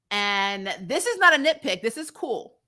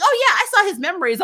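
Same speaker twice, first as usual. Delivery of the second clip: high casual voice; 9 semitones higher, 5 dB louder, faster than usual. Retold or imitated. Mel voiced casually in recap.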